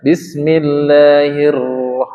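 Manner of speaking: bismillahirrah- slowly, the doubled r pressed but thin; light pressed r. Shown as a demonstration. Teacher shows the thin r after kasroh, slowly. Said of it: "tidak tebal bunyinya karena dia kasroh".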